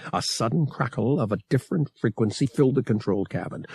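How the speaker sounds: ordinary delivery, nothing marked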